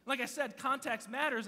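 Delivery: ordinary talk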